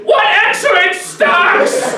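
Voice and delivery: high, slow mock shout; calling out over the asparagus as a cover.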